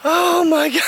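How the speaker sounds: very high, loud squealing laugh; delighted laughter. Real, not acted. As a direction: burst out in delight. Delight it was filmed.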